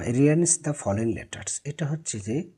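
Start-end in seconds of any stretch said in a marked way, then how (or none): none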